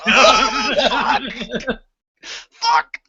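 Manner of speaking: high, strained cries through a laugh; hammed-up gut pain, half laughing; mock pained groan. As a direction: act out a sudden cramp hitting. Acting out stomach cramps.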